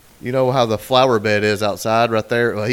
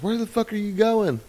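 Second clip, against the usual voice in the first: much higher imitation of a scolding wife; mock angry wife; 10 semitones higher than usual. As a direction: squeak it like the nagging wife. Wife yelling at husband outside.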